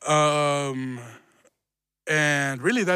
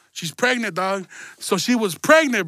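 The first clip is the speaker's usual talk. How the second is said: ordinary talk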